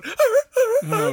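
hammed-up whimpering yelps; dog yelping. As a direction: yelp like a hurt little dog. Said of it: "while it's going".

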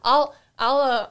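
normal talking voice

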